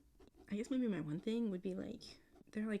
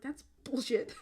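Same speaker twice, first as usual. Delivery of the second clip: higher, louder exasperated verdict; fed-up reader calling it out; indignant outburst; 5 semitones higher, 6 dB louder, slower than usual. Retold or imitated. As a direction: spit it out in mock outrage. Ranting at fictional characters.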